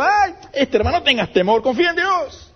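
high-pitched, syrupy imitation of a well-meaning brother; mock comforting brother. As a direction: put on a sugary voice, mocking easy comfort. Mocking a comforting church brother.

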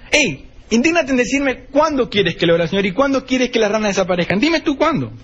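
high, bold dare; defiant challenge. Acted. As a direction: throw the question back as a dare. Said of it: "haciendo un desafío".

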